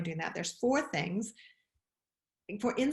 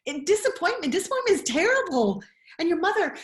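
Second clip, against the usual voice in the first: emphatic; 8 semitones higher, 5 dB louder, faster than usual. Real, not acted. Raised voice, far.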